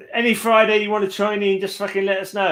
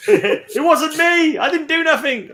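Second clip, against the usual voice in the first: mock innocent protest; 10 semitones higher, slower than usual.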